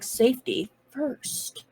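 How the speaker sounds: soft, slow, measured words; slow and deliberate. Acted. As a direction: say it carefully, one word at a time. Driver's slow, deliberate caution in character.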